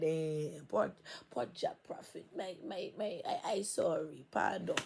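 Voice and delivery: half-whispered fake apology; mock pity. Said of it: "They like".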